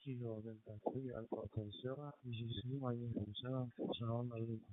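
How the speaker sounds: faint, distant radio transmission; barely coming through the radio; weak radio signal. Real, not acted. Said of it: "I have you very clear, but very weak".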